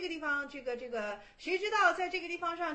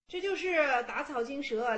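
ordinary talk

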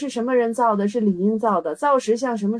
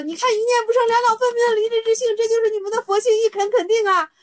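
much higher, fast, teary mock voice of the teacher; imitating teacher Qi; 11 semitones higher, faster than usual. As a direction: squeak it out like a tearful mock master. Imitating teacher Qi's pronouncement.

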